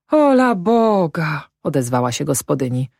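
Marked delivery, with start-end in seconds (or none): 0.1-1.5 high, drawn-out housewife's cry; acted peasant wife, taken aback; dismayed exclamation. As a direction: exclaim slowly in a high wife's voice.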